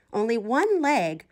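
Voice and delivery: plain reading voice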